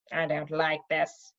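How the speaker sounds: a sulky wizard grumbling slowly; grumbled. Acted. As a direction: grouse under your breath. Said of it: "grumbled one".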